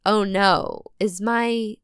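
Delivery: usual speaking voice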